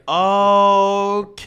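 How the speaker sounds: loud, long-held okay; drawn-out realisation. Pretend. Predicting friend's reaction on hearing it, long loud okay. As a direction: stretch it out as dawning recognition.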